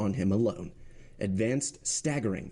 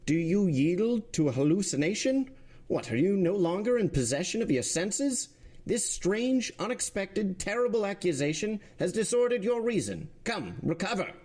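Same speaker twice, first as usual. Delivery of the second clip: much higher, urgent judge's voice; alarmed president; 10 semitones higher than usual. Acted. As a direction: shake him back to his senses, higher. President scolding the prosecutor.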